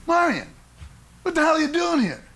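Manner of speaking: surprised shout. Retold or imitated. Surprise meeting old friend.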